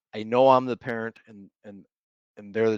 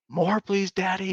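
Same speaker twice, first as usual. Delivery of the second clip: a lightly voiced little girl, sweetly asking; hint of a small daughter begging for more noodles; 8 semitones higher, slower than usual. Dad quoting his daughter begging for more noodles, lightly voiced little girl.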